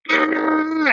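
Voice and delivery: high-pitched imitated war cry; kid's battle cry. Child charging the red shirt, high mock war cry. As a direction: squeal the charge like a tiny Klingon. Kid charges with his blade.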